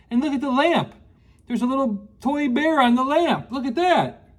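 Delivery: usual speaking voice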